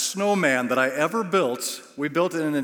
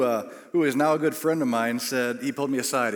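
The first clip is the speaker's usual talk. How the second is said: ordinary delivery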